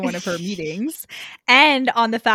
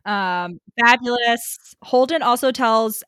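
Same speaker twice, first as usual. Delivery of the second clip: usual speaking voice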